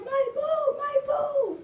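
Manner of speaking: very high, whiny panicked cry; panicked squeal. Retold or imitated. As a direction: squeal it like you're mortified. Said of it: "and I was like".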